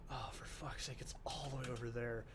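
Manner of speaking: low, very soft grumble under the breath; hushed, annoyed self-talk; exasperated mutter. Real, not acted. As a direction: grumble quietly to yourself. Exasperated muttering while playing.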